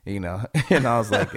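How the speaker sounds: ordinary talk, nothing marked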